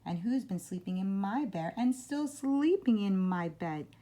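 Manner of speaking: put-on high, loud teeny weeny voice; little bear's outcry, climbing high on the second half; Baby Bear. A narrator's reading. Baby Bear finds someone still in bed, teeny weeny voice. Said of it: "in a teeny weeny voice".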